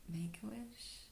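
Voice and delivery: soft unvoiced whisper. Real, not acted. To viewers at 11:11, a hushed whisper. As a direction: breathe it like a secret. Noticing 11:11, makes a wish.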